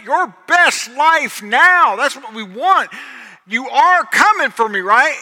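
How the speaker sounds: loud, high, pleading preacher cry; full-force preacher plea; preaching at full force. Acted. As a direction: belt it out to the back row. Preaching climax, voicing John's plea.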